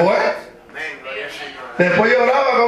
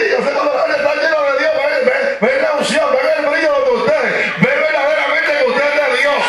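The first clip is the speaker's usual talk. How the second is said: preaching again; 6 semitones higher than usual. Acted. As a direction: build back up to the pulpit voice. Preaching resumes after the story.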